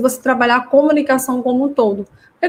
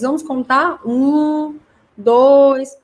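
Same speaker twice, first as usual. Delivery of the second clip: drawn-out counting, pitched up; contagem lúdica; 4 semitones higher, 3 dB louder, much slower than usual. Shown as a demonstration. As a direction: count aloud for a child, stretching each number. Demonstra contagem ao guardar brinquedos. (contagem arrastada para criança)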